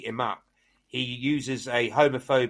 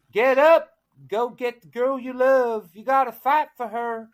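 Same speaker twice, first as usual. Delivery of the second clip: Good Witch; 11 semitones higher, 3 dB louder than usual. Acted. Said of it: "he's visited by the Good Witch".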